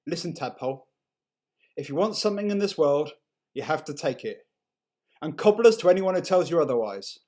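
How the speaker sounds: a hint of a brash dad, rising loud at the end; dad's voice. A narrator's reading. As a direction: play the blustering dad handing out life advice. Dad's advice voiced in story.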